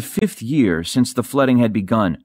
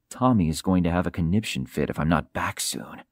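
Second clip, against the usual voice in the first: low, soft inner voice; musing to oneself; 6 semitones lower, 7 dB quieter than usual. A narrator's reading. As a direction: think it privately, low and quiet. Ace muses to himself, amused.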